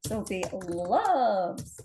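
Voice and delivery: LOVES drawn way out, very slow; writing aloud. Shown as a demonstration. Busting the blunder about Sophie, slowly writing aloud. Writes the corrected sentence aloud.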